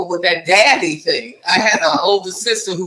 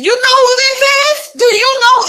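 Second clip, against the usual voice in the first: high, loud, eager motherly quizzing; a proud mom teasing her son, pitched way up; high excited mother voice; 5 dB louder than usual. Retold or imitated.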